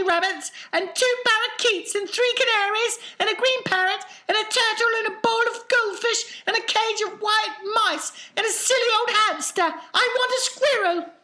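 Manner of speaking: high, demanding brat voice; spoilt girl. Acted. Veruca demanding a squirrel.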